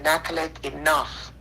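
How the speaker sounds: lightly voiced God calling her name firmly; a hint of a grave divine voice. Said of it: "Until God said".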